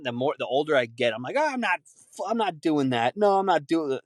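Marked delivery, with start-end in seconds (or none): 1.4-4.0 high huffy refusal; mock old grump. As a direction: play yourself as a stubborn old man.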